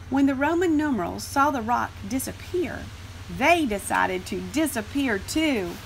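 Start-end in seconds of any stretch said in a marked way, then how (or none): none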